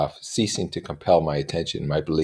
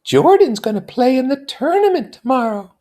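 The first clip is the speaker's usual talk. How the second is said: put-on high young woman voice; Daisy voice; 8 dB louder than usual. A narrator's reading. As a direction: voice her much higher and louder. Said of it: "explained Daisy".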